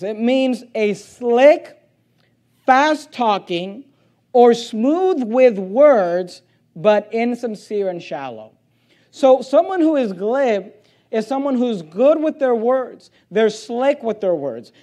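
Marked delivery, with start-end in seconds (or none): 0.0-8.5 each word stretched slow; slow, drawn-out definition. Shown as a demonstration. Preacher slowly spelling out what glib means. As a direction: linger on every word of the meaning. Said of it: "here's what the word glib means".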